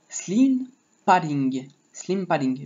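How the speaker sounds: teacher showing how "slim padding" is said; own pronunciation. Shown as a demonstration. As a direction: spell out "slim padding" slowly the English way. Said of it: "je le prononce comme ça".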